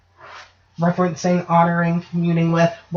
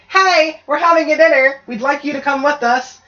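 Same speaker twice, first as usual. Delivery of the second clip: higher, louder friendly invitation; mock call to the dead; 7 semitones higher, 3 dB louder than usual. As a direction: call the dead to dinner cheerfully. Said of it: "you tell them".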